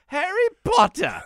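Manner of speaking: hammed-up British accent; mock British accent. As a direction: say it like a posh English kid. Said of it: "There we go".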